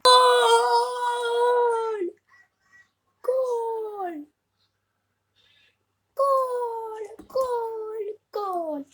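loud, high drawn-out shouts, gradually easing; goal celebration. Real, not acted. Gamer celebrating his own goal, long jubilant shouts. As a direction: bellow it like a commentator at a goal.

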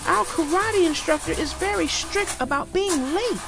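high-voiced cub complaining; yelled complaint. A narrator's reading. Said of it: "yelled Sister".

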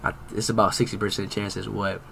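very low, soft muttering; muttered reasoning. Real, not acted. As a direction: think aloud under your breath. Reasoning to self over a guess.

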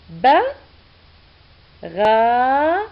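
ordinary talk

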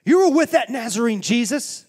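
loud, high accusing cry; accusing shout. Acted. Servant girl accusing Peter, loud high outcry.

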